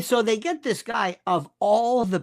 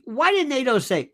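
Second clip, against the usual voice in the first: ordinary delivery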